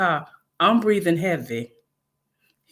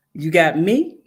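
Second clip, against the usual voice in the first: usual speaking voice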